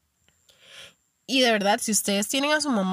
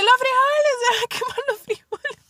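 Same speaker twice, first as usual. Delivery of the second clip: high, loud mock mother's alarm; mom shouting about beans; 4 dB louder than usual. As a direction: raise the alarm like a mom over the stove. Mom warning beans will burn.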